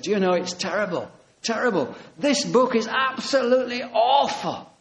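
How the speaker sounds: retold dismay, pitched high; mock distress. Man telling the preacher the book overwhelms him, acted dismay.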